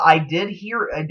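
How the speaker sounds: ordinary talk, nothing marked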